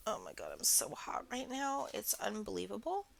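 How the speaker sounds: normal talking voice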